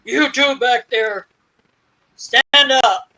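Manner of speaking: slow, loud command through a bullhorn; retold police bullhorn order to the couple; mock bullhorn call. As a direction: call them out like a cop on a megaphone. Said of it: "gets on his bullhorn and says".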